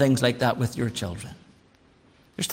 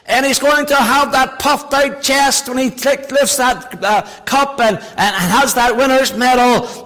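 higher, louder pulpit build; rising preaching; 8 semitones higher, 4 dB louder than usual. Acted. Preacher to congregation about a proud footballer, building. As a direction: build the sermon toward a point.

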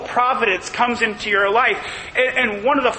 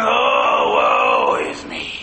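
mock groaning; much slower than usual. Preacher mimicking a self-pitying groaner, mock groan. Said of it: "it's not you just going".

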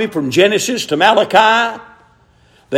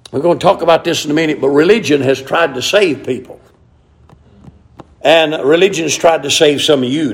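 usual speaking voice

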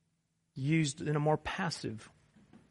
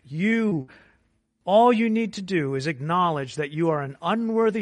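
loud, rising sermon climax; full-force preaching; 3 semitones higher, 8 dB louder than usual. Acted.

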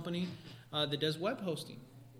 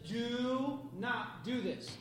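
slow stern warning; 4 semitones higher, much slower than usual. Shown as a demonstration. Parent warning child sternly.

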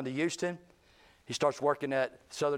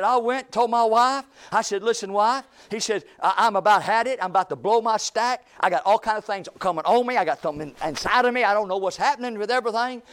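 1 worked up; 6 semitones higher, 8 dB louder than usual. Retold or imitated. Coworker retelling his blowup.

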